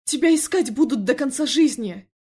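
loud, high angry warning; barked. A narrator's reading. Felix warning his brother he will be hunted, shouting. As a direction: holler the warning at him. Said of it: "гаркнул Феликс".

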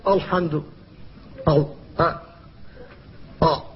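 الحمد with a thick, emphatic hamza; mock mistaken thick أ; wrong heavy hamza. Shown as a demonstration. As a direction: darken the hamza of الحمد on purpose. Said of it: "فاحذر أن تقول".

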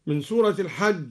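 ordinary delivery

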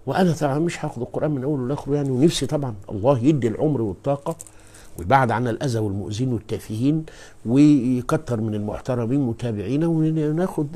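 ordinary delivery

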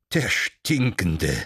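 slow, gleeful mockery, dragging out each word; slow and cheerful. A narrator's reading. Cervantes taunting the frightened friar, slow and gleeful. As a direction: savour the insult, slowly and merrily. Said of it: "wiederholte Cervantes langsam und fröhlich".

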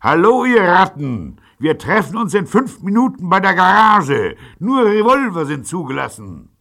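very high, loud challenge; hailing rivals through a paper megaphone; calling through megaphone. A narrator's reading. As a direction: shout it across to the enemy. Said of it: "David faltete eine Flüstertüte".

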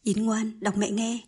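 ordinary delivery

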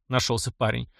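ordinary delivery, nothing marked

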